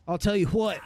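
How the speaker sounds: put-on Floridian accent, what as "hwat". Imitating Floridians, Floridian accent.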